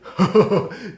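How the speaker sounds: put-on chuckling before speaking; imitated laugh. Friend reacting to the big song, imitated laughter. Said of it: "And he said".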